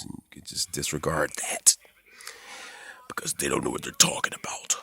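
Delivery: hushed secret to the viewer; whispered aside. Real, not acted. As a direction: lean in and whisper it.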